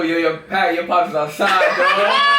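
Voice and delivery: loud playful callout; calling out. Retold or imitated. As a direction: shout it like a friend at the door. Friends calling out pops outside.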